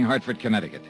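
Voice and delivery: ordinary talk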